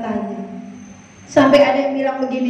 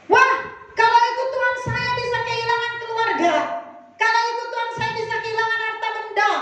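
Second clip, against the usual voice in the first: higher, anxious voice of a hesitant believer, then faster; worried doubter; 7 semitones higher, faster than usual. Retold or imitated. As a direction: voice the fearful excuses of a doubter.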